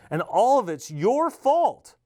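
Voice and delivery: high, slow, loud blaming line; accusing. Acted. Mother scolding the governor over her children: high angry accusation. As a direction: pin the blame on him hard.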